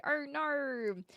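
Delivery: mock Australian drawn-out "no"; lightly hammed Australian vowel on "no"; Australian accent. Shown as a demonstration. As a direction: put on a soft, exaggerated Australian accent. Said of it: "I'm not Australian, so I don't say".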